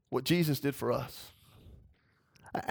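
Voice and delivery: ordinary talk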